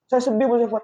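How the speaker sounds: high, mildly sorrowful imitation; lightly acted weeping man; retold tearful confession.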